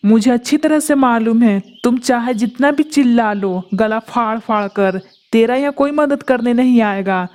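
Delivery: a greedy kite relishing its meal; menacing, savoring every word; gloating predator. Acted. The kite gloats over the two trapped birds, menacing.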